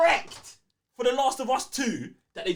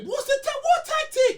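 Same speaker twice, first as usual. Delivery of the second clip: incredulous squeak; 5 dB louder than usual. Real, not acted. Incredulous interruption.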